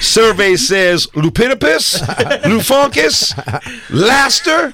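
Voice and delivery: high, slow shouted survey call; game host shout. Retold or imitated. Steve Harvey survey says.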